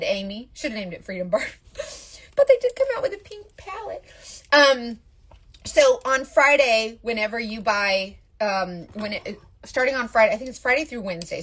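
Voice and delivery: ordinary talk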